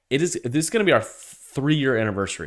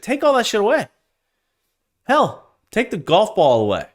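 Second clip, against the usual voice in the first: much higher, exasperated outburst; fired up; 9 semitones higher than usual. Real, not acted. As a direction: throw it away in pure exasperation. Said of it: "I got a little fired up".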